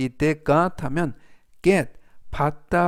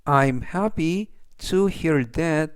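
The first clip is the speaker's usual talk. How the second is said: slow, clear reading; much slower than usual. Shown as a demonstration. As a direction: read very slowly, word by word. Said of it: "전체적 의미는".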